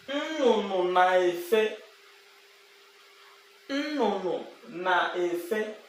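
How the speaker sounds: slow demo. Shown as a demonstration. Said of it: "slow teaching model phrase".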